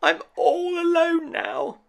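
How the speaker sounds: very high small toy voice, alone in the dark; a tiny rabbit left behind; Tom Rabbit forlorn. Acted. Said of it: "thought Tom Rabbit".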